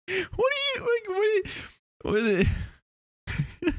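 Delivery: very high, slow, squeaky mocking voice while laughing; mock squeal. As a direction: squeak it at the listeners, half laughing.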